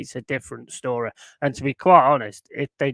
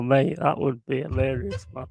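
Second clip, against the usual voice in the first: usual speaking voice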